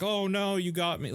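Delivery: high-pitched pretend "shot" reaction; mock dying cry. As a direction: play a kid hammily hit by finger guns. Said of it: "pretending to shoot each other ... being like".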